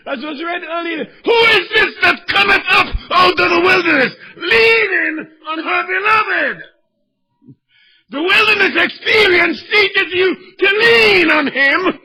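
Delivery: high, loud, cracking preacher's cry; impassioned pulpit shout; fiery preaching. Acted. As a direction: preach it out at full force. Preaching at full force.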